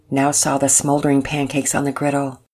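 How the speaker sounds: ordinary delivery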